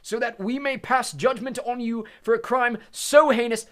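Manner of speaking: high, loud, slow and stern Crouch; stern judge. Acted. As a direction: pronounce the charge coldly. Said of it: "he said clearly".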